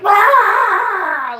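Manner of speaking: long, high mock scream; screaming out loud. Freaking out in somebody else's house, imitated loud scream. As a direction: imitate a tantrum scream at full volume. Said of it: "like, screaming out loud".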